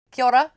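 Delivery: "Kia ora" said as kee-oh-rah, rising end; "Kia ora" with a bright rising "ora"; Maori greeting. Shown as a demonstration. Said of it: "which is hello in Maori".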